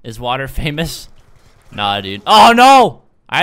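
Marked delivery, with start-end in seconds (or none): 2.3-3.0 loud, high cry of dismay; dismayed shout. Real, not acted. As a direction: yell out as the play goes wrong. Game mishap, cries out.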